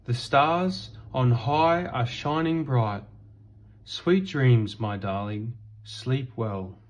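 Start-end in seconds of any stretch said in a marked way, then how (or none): none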